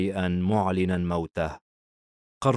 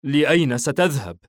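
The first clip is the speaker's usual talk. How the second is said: raised, louder startled question; shocked; 6 semitones higher, 5 dB louder than usual. A narrator's reading. Madi learns his friend is leaving, shocked question. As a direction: ask in mild shock, pitch lifted. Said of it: "وبصدمة يقول".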